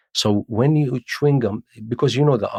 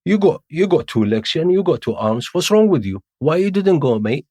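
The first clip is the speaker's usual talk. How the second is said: high, loud, mock-incredulous questioning; mocking joke; 7 semitones higher, 5 dB louder, much faster than usual. Soldiers joking at a man's exemption ID, teasing. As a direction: needle him jokingly in a raised pitch. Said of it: "sometimes they make a joke".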